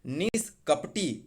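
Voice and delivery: ordinary talk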